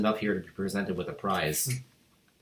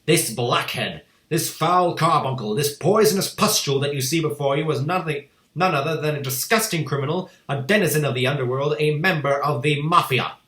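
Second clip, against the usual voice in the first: furious tirade; 5 semitones higher, 6 dB louder than usual. A narrator's reading. Headmistress denouncing the boy to the school, loud scorn. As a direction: spit each insult with disgust.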